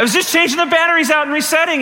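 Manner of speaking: high, strained protest; mock exasperation. Man protesting to congregation how simple the repair was, mock exasperated. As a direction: pitch it way up in comic disbelief. Incredulous repeat after costly failure.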